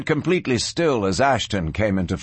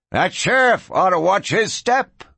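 high-pitched croak of a barroom drinker; excited high croak; 8 semitones higher than usual. A narrator's reading. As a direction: croak it out high, like an onlooking drunk. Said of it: "croaked a red-faced drinker".

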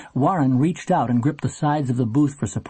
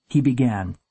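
ordinary delivery, nothing marked

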